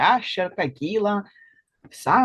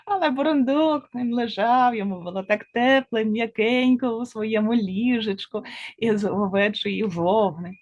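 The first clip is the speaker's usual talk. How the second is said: ordinary delivery, nothing marked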